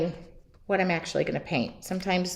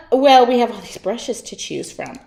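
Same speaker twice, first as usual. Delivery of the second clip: usual speaking voice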